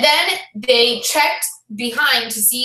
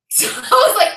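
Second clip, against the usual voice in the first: normal talking voice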